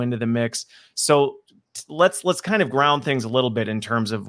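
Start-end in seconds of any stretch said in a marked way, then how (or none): none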